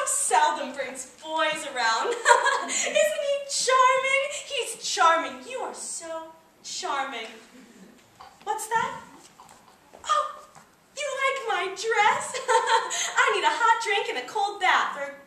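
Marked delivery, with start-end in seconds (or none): 2.1-2.6 high, short giddy laugh; theatrical hostess giggle; gushing laugh. Acted. Hostess gushing over visiting boy. 12.4-13.1 high, coy little acted laugh; short pleased titter; flattered laugh. Hostess flattered about her dress.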